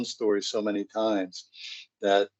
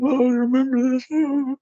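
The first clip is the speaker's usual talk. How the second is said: much higher, wobbly sentimental voice; tearful mom reminiscing; 5 dB louder than usual. Retold or imitated. Mom going through old photos, high and tearful. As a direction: reminisce as if about to cry. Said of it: "mom looking at old photos".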